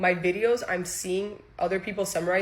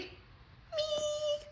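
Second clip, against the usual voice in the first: tiny, very high squeak; comic squeal; 11 dB quieter than usual. Acted. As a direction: squeak it softly, far above your own voice. Answers own rhetorical question comically.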